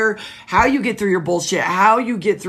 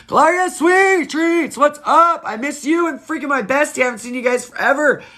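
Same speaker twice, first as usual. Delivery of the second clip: excited greeting; 7 semitones higher than usual. Real, not acted.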